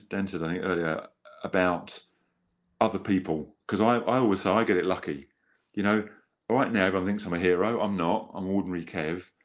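normal talking voice

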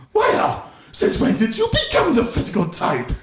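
high-pitched movie bully impression; Griff Tannen. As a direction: put on a high, taunting villain voice. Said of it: "That was Griff Tannen".

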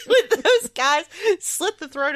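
words bubbling with laughter; amused, laughing while reading the joke; laughing through it. Real, not acted. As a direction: giggle your way through the line. Laughing at absurd sacrificial boxes.

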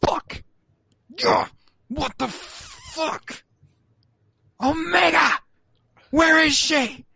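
high, strained panicked yelling; mock frantic cry for Omega; Hunter panicking. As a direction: shout for the lost girl in panic. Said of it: "Hunter's like".

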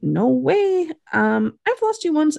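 high, insistent imitated protest; acted refusal. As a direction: refuse with the pitch lifted high. Acts Hot refusing.

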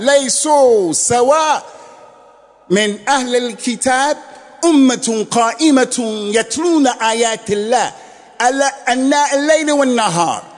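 fast chanted Arabic verse recitation; Quranic recitation. Acted. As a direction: recite the verse in rapid cantillation.

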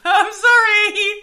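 high, very slow pitiful apology; meek apology. Acted. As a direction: squeak out a timid sorry. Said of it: "Yamuk kinda just goes".

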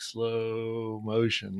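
stretched-out, dragging slow words; slow motion. Shown as a demonstration. Describing creeping down toward the elk: very slow, drawn-out. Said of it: "this dude's never moved so slow".

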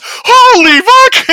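drawn-out, very high, very loud yell; full-blast imitation of a starstruck fan; ecstatic fan scream. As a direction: scream in stunned delight, stretching each word. Repeats the stunned fan's outburst.

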